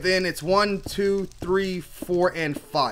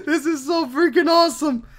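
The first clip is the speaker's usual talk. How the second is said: high-pitched, laughing delight; giddy with real joy; elated; 5 semitones higher than usual. Real, not acted. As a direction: say it through happy laughter. Elated after finding the biome.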